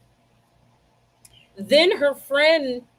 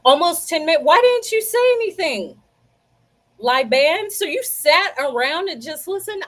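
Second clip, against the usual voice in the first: high, loud incredulous complaint; real exasperation, high and punchy; exasperated scolding; 6 semitones higher, 5 dB louder than usual. Real, not acted. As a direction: demand an explanation, pitched up and loud. Scolding viewers over missing sound.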